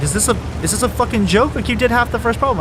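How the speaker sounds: mildly mock the teacher's shock; incredulous teacher. Teacher to student quitting midterm, mild incredulous tone. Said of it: "he looks at me and goes".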